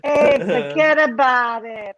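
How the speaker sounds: slow, laughing fake Brooklyn accent; laughing through a Brooklyn line. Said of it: "do a fake Brooklyn accent".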